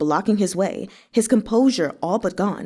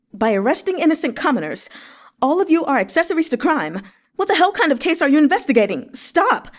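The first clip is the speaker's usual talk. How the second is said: higher, louder, fast, rising to a yell; furious shout; 7 semitones higher, 3 dB louder, faster than usual. A narrator's reading. Xue Xiu Zhuo grabbing the guard's arm, yelling in fury. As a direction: explode at them to stop the arrest.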